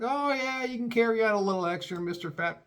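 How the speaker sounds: high, breezy mock agent voice; mocking airline agent. Agent waving the fat man's bag through, mock high breezy. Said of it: "They were like".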